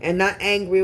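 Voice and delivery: usual speaking voice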